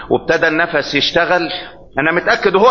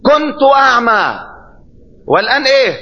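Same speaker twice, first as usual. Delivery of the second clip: exclaimed testimony; 8 semitones higher than usual. Acted. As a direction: proclaim it like a joyful witness. Quoting healed blind man.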